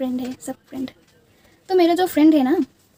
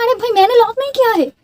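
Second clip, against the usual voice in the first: higher, louder insistent protest; retold protest; 8 semitones higher, 5 dB louder than usual. Telling the trapped friend she didn't lock it, raised voice. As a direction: plead your innocence, voice raised. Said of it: "मैंने कहा".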